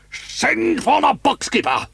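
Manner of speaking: slow, high, loud furious shout. A narrator's reading. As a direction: bellow the order in rage. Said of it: "he shouted".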